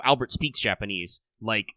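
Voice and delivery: ordinary talk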